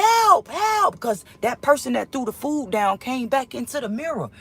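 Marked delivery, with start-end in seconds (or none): 0.0-1.0 high, loud yelling help; calling for help. Retold or imitated. Dizzy in the padded cell, calling loudly for help. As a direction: cry out help up and up. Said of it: "I started saying".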